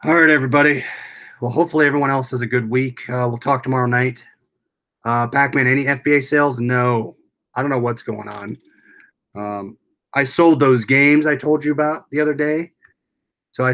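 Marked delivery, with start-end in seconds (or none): none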